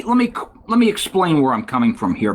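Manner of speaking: ordinary talk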